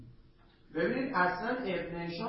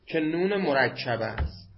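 normal talking voice

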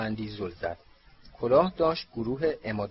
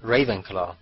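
mildly shouted announcement; a restrained storyteller's shout; 3 semitones lower, 4 dB louder than usual. A narrator's reading. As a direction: call out the verdict. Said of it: "کلاه فریاد زد".

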